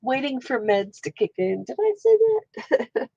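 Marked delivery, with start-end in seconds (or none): 1.6-3.1 high, slow, amused disbelief; surprised. Real, not acted. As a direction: catch yourself in surprise. Surprised at own words.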